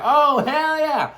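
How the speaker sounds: high, drawn-out cheer; stretched, high-pitched approval; excited agreement. Acted. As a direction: imitate an impressed friend. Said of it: "They'll be like".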